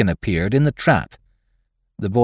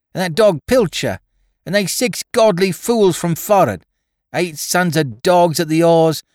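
high, louder bratty oaths; angry cursing boy; 7 semitones higher, 4 dB louder than usual. Acted. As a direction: rant like a spiteful ship's boy. Boy's cursing rant continues.

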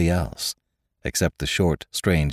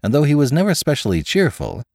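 plain reading voice

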